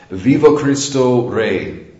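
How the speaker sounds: slow, drawn-out mock rallying cry; retold martyr's shout. Priest retelling a martyr's last words, mild slow proclaiming. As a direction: proclaim it slowly like a defiant last cry, lightly voiced. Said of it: "he shouted out a, a famous saying".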